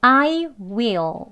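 I and WILL as two full, drawn-out words; unreduced demo of "I will"; full uncontracted form. Shown as a demonstration. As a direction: say "I will" slowly, both words complete. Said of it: "단계적으로 줄여나가는 것 순서대로 보여드릴게요".

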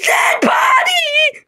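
high, slow, wailing shriek; horrified scream. Acted. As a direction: scream it out in shock. Mario sees the body.

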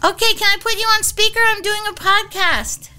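high, quick phone voice to a loved one; talking to daughter. Real, not acted.

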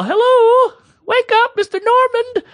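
very high, loud singsong caller impression; wake-up caller. Hotel operator waking a hungover guest, high chirpy imitation. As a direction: trill it out like an overeager operator.

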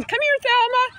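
high sing-song call to a dog. Retold or imitated. Calling the dog over.

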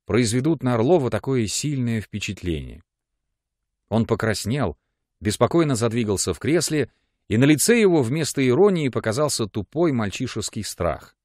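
plain reading voice

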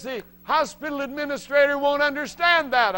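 high, loud exasperated doctor; doctor fretting over his report; baffled doctor. Retold or imitated. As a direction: protest like a flustered doctor. Doctor worried about report.

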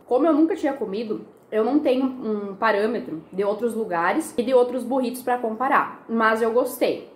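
talking between bites; chewing while talking. Real, not acted. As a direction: mouth partly full of food. Seen 0: Bites burrito at -2; lips pressed, chewing motions between words throughout.

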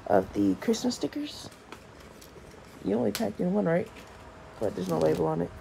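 raspy just-woke-up voice; scratchy, hoarse morning voice. Real, not acted. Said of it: "Sorry for my morning scratchy voice".

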